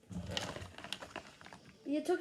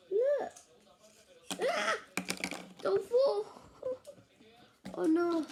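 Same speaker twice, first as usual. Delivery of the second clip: excited; 6 semitones higher than usual. Real, not acted. Something about to fall.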